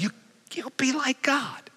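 hushed, whispered enticement; tempter's whisper. Acted. Tempter luring Eve in the garden.

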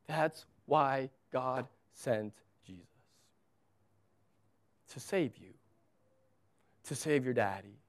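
retold comfort, low and hushed with long pauses; tender fatherly reassurance. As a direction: softly console the child, letting each phrase settle. Father comforting daughter after a hug.

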